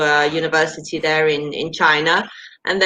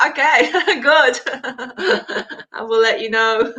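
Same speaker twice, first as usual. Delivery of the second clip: laughing through words; 5 semitones higher than usual. Real, not acted. Laughing at the suggestion.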